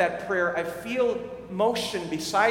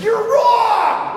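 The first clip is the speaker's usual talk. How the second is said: a sudden loud, much higher shout; blunt retort; 13 dB louder than usual. Retold or imitated. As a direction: blurt it out as if you can't hold it in. Inner urge to contradict stranger.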